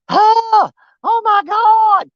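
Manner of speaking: high, loud, hammed-up gasp of delight; mock excitement. Fisherman to viewers, mocking his own catch reaction. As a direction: fake the big catch thrill. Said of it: "go through the whole spiel of".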